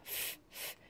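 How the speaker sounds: f soufflé, sans voix; whispered f sound. Shown as a demonstration. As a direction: souffler le son f tout bas. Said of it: "Alors, f". (son f chuchoté)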